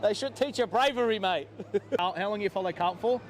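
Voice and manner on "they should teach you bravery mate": playful taunt at a rival fan; mock scolding. Real, not acted. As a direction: rib the rival fan like a cheeky coach.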